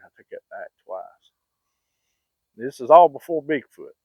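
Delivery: ordinary delivery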